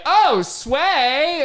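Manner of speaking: high, loud, slow squealing tipsy woman; drunk lady. Retold or imitated. Drunk lady fans begging the mascot, squealing drunk voice. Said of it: "they just like".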